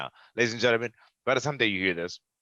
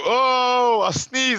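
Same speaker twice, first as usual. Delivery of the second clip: high, loud goofy ref voice; mock referee; 6 dB louder, much slower than usual. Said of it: "he's like".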